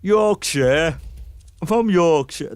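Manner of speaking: hammed-up Yorkshire vowels, Yorksheh; a poor Yorkshire accent attempt; mock Yorkshire accent. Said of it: "That's the worst accent ever".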